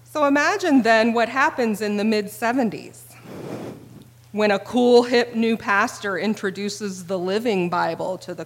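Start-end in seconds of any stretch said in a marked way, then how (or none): none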